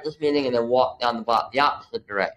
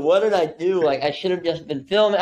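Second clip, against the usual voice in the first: panicked self-questioning; 6 semitones higher than usual. Retold or imitated. Telling the host how he panicked on day one, frantic and higher. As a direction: replay the panic of a rookie who just blew it. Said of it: "the production person in me was just like".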